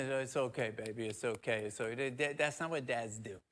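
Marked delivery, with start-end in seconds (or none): 0.0-1.7 a mother's hushed, soft comfort; soothing mom. Retold or imitated. Mom soothing her grown son, soft and hushed. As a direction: coo it softly like a mom patting a hand. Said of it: "They say".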